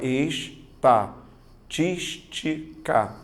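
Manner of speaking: es-ta-tís-ti-ca split very slowly by syllable; slow syllable-split demo. Shown as a demonstration. Teacher showing students the syllable split, very slow. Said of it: "Se você fizer a separação silábica".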